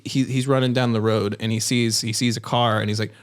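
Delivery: ordinary talk, nothing marked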